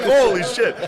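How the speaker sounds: high, loud shocked cry of holy shit; shocked yelp. Retold or imitated. Wife waking up shot, yelping in loud shock. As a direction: blurt it out like you just got shot awake.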